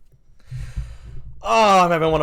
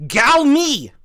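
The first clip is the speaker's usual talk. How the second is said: loud, higher-pitched gibberish exclamation; dubbed movie line; 7 semitones higher, 6 dB louder than usual. Retold or imitated. Comic mocking a TV edit of an action movie, loud dubbed exclamation. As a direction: blurt it out loud and high. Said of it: "What he says".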